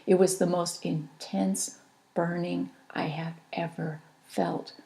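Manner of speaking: ordinary delivery, nothing marked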